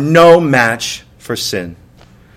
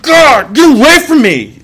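higher, half-hearted yell of a parent fed up; mock yell at kids; 8 semitones higher, 3 dB louder than usual. Parent yelling at the kids, mild mock yell. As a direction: snap at the kids as if losing it. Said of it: "you are screaming your head off at the kids".

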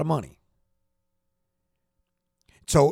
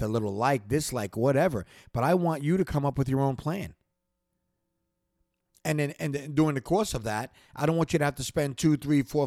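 ordinary talk, nothing marked

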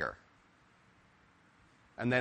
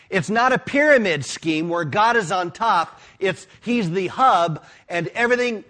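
fiery preaching; 6 semitones higher, 6 dB louder than usual. Acted. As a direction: preach it to the congregation with rising fire. Preaching God at the center.

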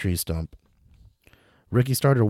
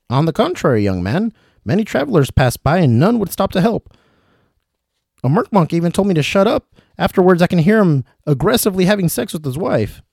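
put-on dangling old traveler; 10 semitones higher, 6 dB louder than usual. William calling to Ricky from upside down, high and fussy. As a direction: call down politely from the rope.

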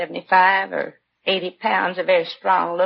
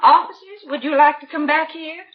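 much higher and louder, calling across the house; 7 semitones higher, 5 dB louder than usual. Acted. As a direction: call the officers back to the room. Wife calls officers from back room.

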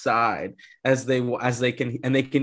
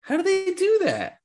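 squeaky, incredulous question far above usual pitch; high-pitched bafflement. Real, not acted. Co-host puzzling over the witches' magic, high-pitched bafflement.

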